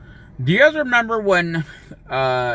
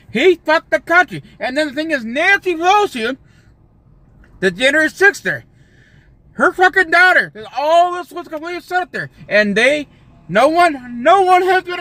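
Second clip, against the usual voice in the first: angry rant; 8 semitones higher, 4 dB louder than usual. Real, not acted. Ranting about January sixth.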